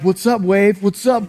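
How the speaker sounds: brash jock greeting a wave head-on; tough-guy taunt, lightly voiced; cocky football jock. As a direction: square up to the wave like a linebacker. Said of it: "And then they're like".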